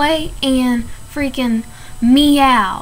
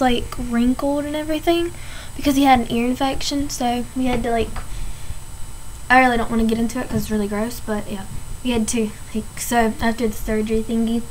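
ordinary talk

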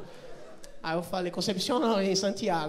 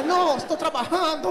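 high, loud imitation of the clerk; funcionária exaltada; 7 semitones higher, 5 dB louder than usual. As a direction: protest loudly as the harried clerk. (imitação exaltada da funcionária, alta e aguda)

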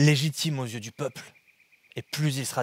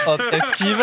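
laughing; 7 semitones higher, 9 dB louder than usual. Real, not acted. Laughing dodge in play.

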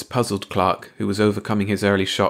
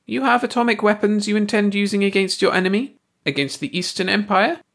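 high alien voice; 12 semitones higher than usual. A narrator's reading. Ursi questions Clark.

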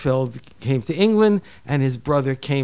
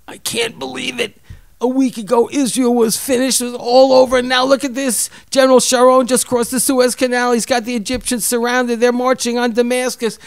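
high, far-off voice of a man overcome; Walter's crying rant; 11 semitones higher, 4 dB louder than usual. Retold or imitated. Husband retells friend crying at the TV news. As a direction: play the old man weeping with joy over the war news. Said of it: "and he's crying".

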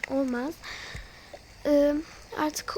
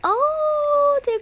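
sharp, much higher and louder startled cry; startled gasp; 11 semitones higher, 8 dB louder than usual. Real, not acted. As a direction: jump at a sudden sound. Sudden noise in the forest. (ani korkuyla irkilme)

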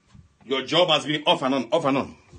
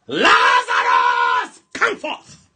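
Jesus shouting; 8 semitones lower, 10 dB louder, much slower than usual. Retold or imitated. Jesus calling Lazarus from the tomb, stretched shout. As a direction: call him out of the tomb with a slow shout. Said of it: "And then he shouted".

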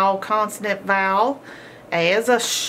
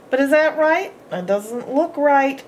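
higher, louder feigned doubt; mock surprise; 6 semitones higher, 4 dB louder than usual. Teacher acting surprised at the spelling, mock puzzled. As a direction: play puzzled for the class.

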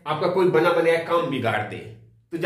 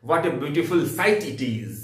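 slow admiring exclamation; much slower than usual. Shown as a demonstration. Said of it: "picnic मनाने गए हुए हो".

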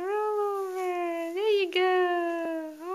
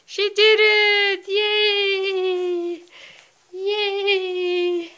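excited cheering; 4 semitones higher, 8 dB louder, much slower than usual. Real, not acted. Baby just rolled over, cheering.

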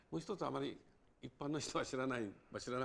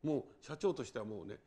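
ordinary talk, nothing marked

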